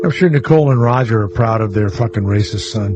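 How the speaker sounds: ordinary talk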